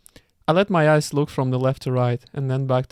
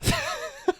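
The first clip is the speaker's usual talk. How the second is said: high giggle; 8 dB quieter than usual. Real, not acted. Reader breaks up laughing.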